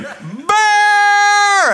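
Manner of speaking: sudden high yelled cry; screaming. Retold or imitated. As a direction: yell it out in panic. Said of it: "And he is screaming".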